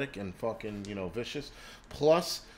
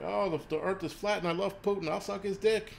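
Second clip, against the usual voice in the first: higher, dopey sneering imitation; mocking flat earther; 6 semitones higher than usual. Mocking flat-earth Putin fans on his channel, higher and dopey. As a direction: play the gullible fool with contempt. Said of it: "That's what you guys sound like".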